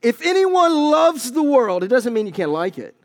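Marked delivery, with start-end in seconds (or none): 0.0-1.8 much higher, louder, slow declamation; proclaimed verse. Acted. Preacher declaiming the verse to the congregation, high and slow. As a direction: ring the verse out slowly. Reads scripture forcefully.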